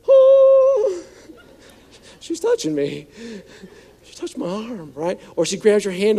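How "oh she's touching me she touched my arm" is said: put-on squealing high, laughing teen thrilled by a touch; giddy teen. Teen boy at the haunted house, giddy and laughing. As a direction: act the boy giddy over a girl's touch. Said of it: "and you're like".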